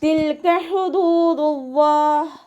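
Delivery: തിൽക with half sukun on ല്, slow chant; tajweed recitation. Shown as a demonstration. Teacher models the phrase for students, slow chant. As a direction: recite ഹുദൂദുല്ലാഹ് high and drawn. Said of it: "half സുക്കൂന് കൊടുക്കുക".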